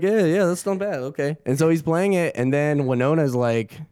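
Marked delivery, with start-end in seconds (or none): 0.0-1.4 higher-pitched mock British-Russian approval; casual imitation in British-Russian English; British-Russian accent. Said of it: "sounds a little crazy in that accent".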